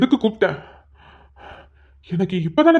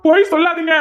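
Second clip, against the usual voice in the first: louder angry retort; angry shout; 7 semitones higher, 3 dB louder than usual. Acted. Son angrily cuts off his aunt's denial. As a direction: cut her off angrily. Son accuses aunt of lying.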